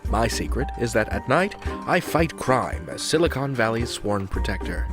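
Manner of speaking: normal talking voice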